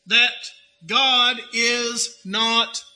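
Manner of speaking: very slow, word by word; slow emphasis. Acted. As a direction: spell out "that God is not fair." slowly. Key claim repeated very slowly.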